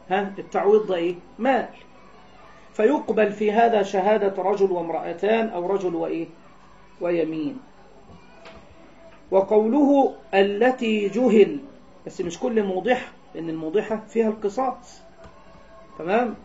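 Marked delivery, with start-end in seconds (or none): none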